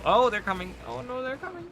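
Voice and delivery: sudden high alarmed cry. Real, not acted. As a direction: panic as they rush in. Enemies charging at him.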